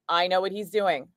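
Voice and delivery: normal talking voice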